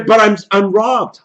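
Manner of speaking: normal talking voice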